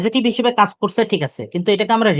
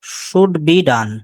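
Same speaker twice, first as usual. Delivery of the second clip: slow dictation; 3 semitones lower, much slower than usual. Shown as a demonstration. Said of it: "খুব দ্রুত বলবেন".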